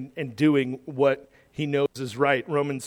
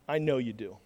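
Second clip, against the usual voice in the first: quiet certainty; 6 semitones lower, 8 dB quieter, faster than usual. Acted. Paul's quiet assured line.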